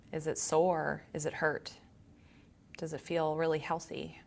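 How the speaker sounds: calm, quiet meditation voice with long pauses; guided meditation. Acted. Yoga teacher leading students to notice body and breath, calm guided meditation. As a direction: guide them gently inward, leaving long silences.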